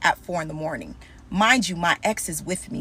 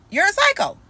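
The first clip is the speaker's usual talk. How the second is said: shouted insult; 12 semitones higher, 4 dB louder than usual. Real, not acted. Insulting her ex directly.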